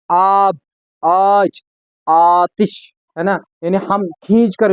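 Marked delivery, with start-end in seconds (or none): 0.1-2.7 आब, आज, आतिश with the आ drawn out long; long aa vowel. Shown as a demonstration. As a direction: stretch the आ in आब, आज, आतिश. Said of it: "खींच कर पढ़ते हैं". (आ को खींच कर लंबा बोलें)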